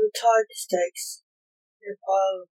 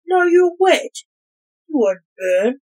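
sharp retort; 8 semitones higher, 10 dB louder than usual. Acted. Witchfinder retorts to Blake.

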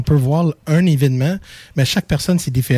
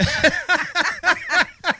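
laughing. Real, not acted. S1 laughs at teasing.

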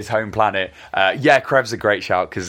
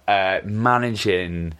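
normal talking voice

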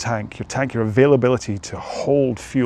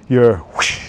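ordinary talk

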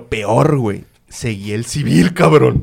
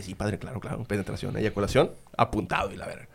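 quiet, fading mocking agreement; hushed mock reply; 6 semitones lower, 11 dB quieter than usual. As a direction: mutter it softly like a smirking yes. Cousin's quoted reply, hushed.

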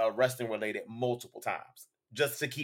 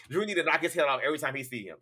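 ordinary delivery

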